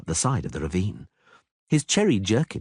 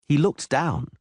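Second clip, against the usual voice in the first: plain reading voice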